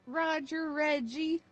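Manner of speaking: slow teasing drawn-out full name; yelling child's full name. Pretend. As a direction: call him out like a parent. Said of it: "She said my full name".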